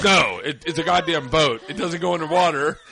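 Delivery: loud, exasperated retold snap; exasperated correction. Russell corrects her about the boat, loud and exasperated. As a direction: set her straight, loudly and fed up.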